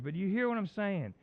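usual speaking voice

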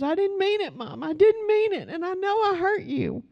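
very high, tearful pleading to mom; bawling daughter. Retold or imitated. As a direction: imitate a sobbing child's high apology. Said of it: "and she was just bawling".

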